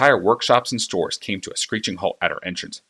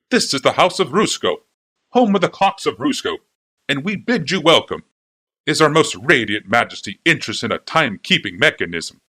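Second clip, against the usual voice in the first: higher, louder gushing shopkeeper; fawning clockmaker; 5 semitones higher, 4 dB louder than usual. A narrator's reading. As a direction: gush over the princess like an eager flatterer. Clockmaker's sales welcome.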